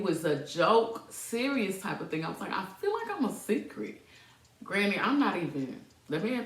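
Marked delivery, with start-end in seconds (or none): 2.5-4.0 acted complaint. Retelling her complaint to boyfriend.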